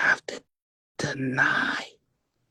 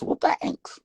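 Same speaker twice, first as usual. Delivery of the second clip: ordinary talk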